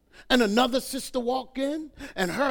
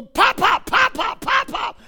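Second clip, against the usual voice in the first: put-on high-pitched, insistent child's call; kids calling; 11 semitones higher, 8 dB louder, slower than usual. Grandkids calling Grandpa across the supermarket, high kiddie shout. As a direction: imitate little grandkids calling out, high and loud. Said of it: "I hear these kids in the store".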